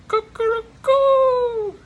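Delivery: loud, high rooster-like call; shouted cuckoo. Retold or imitated. Mystic shouting out at a drunk follower in the circle, loud high cuckoo call. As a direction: sing out a piercing, drawn-out cuckoo. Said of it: "and he shouted out".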